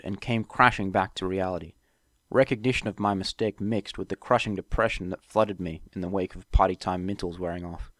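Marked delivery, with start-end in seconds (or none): none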